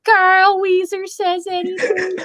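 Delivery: high-pitched cartoon character voice; goofy high-pitched impersonation; Carl Wheezer. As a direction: put on a high, odd cartoon voice for the whole line. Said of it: "That, that Carl Wheezer voice is hilarious".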